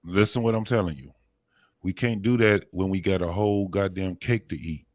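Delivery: normal talking voice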